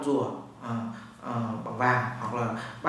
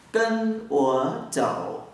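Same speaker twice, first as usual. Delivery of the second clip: slow demo; 2 semitones higher, slower than usual. Shown as a demonstration.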